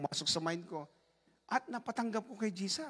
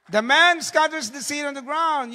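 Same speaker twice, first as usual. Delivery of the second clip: loud, slow, ringing scripture reading; scripture proclamation; 7 semitones higher, 14 dB louder, slower than usual. Acted. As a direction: proclaim the verse to the hall. Quoting scripture aloud. (malakas at mabagal na pagbasa ng Kasulatan)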